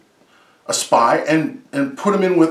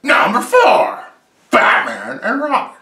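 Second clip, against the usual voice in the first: countdown announcer; 7 semitones higher, slower than usual. Acted. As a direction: announce the entry like a game show host. Countdown title card.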